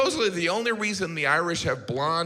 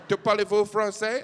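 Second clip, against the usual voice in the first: mock French question; 4 semitones higher than usual. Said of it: "She was like".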